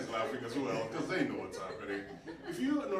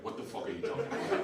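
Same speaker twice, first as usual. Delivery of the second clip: incredulous retort; 10 semitones higher than usual. Retold or imitated. His baffled reply to fans.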